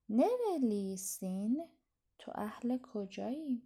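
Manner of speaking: whispered reading. Shown as a demonstration. Teacher reading exercise phrase.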